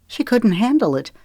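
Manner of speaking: ordinary delivery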